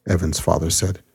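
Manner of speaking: plain reading voice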